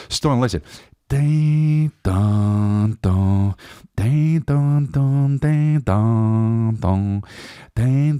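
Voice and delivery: sing-song imitation of a bell ringing; ding dong ringing. Actor chiming a bell tune down the phone.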